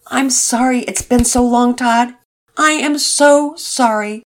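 a father close to tears saying sorry; tearful apology. Acted. Father apologizing to his son, slow tearful voice. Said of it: "seemed to be crying as he talked".

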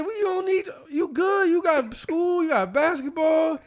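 high-pitched pleading dad; worked-up high reassurance; retold protest. Retold protest to working daughter.

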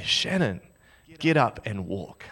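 lightly voiced God, softened and low; quiet, measured command; God speaking. Imagined God addressing the storyteller by name, quiet and solemn. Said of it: "God is gonna say".

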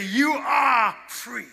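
slow, ringing declaration of freedom; slow proclamation. Retold or imitated. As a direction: deliver the guards' release like good news. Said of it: "they look at him and say".

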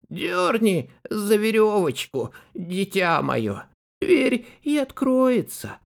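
wolf faking granny's voice; thinner voice. Acted. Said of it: "сказал потоньше".

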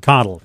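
Coddle as KOD-ul, stress up front; name said like coddling. Shown as a demonstration. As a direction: model Coddle to sound like coddling someone. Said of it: "just like if you're coddling someone".

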